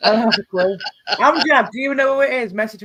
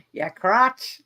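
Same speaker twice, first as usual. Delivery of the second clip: put-on Brooklyn accent; 6 semitones higher than usual. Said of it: "I have to say it in your accent".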